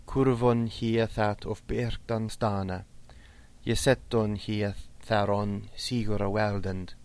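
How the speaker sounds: slow, measured reading of ancient verse; Old English verse recitation. Shown as a demonstration. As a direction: recite the Old English poem slowly, line by line.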